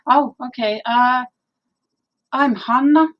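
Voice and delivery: normal talking voice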